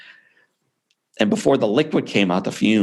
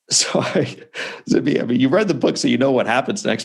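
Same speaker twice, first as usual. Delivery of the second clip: normal talking voice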